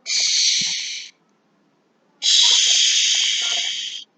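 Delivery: long voiceless hiss; snake hiss. Shown as a demonstration. Teacher showing the noise snakes make, hissing. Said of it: "Snakes make a hiss noise".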